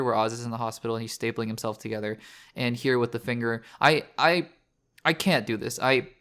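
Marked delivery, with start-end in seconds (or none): none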